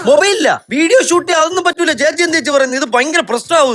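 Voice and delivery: high-pitched, flustered refusal; alarmed protest. Acted. Young man refusing to be filmed by the woman, alarmed.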